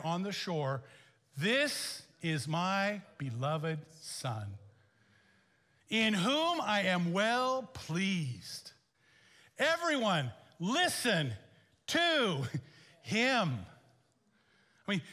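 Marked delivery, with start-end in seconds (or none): 1.4-8.7 very slow, solemn heavenly voice; voice of God. Acted. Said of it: "booming from the sky". 9.6-13.6 higher, louder divine command; booming command from heaven. Acted. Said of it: "booming from the sky".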